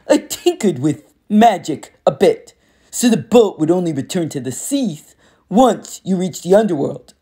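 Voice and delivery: lightly voiced cocky war god, taunting; a hint of a swaggering bully, amused; smug war god. Ares explains the trick.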